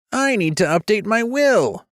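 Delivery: high, frightened squeak; squeaky. Acted. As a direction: squeak it out in panic. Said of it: "Shirapont squeaked, visibly shaking".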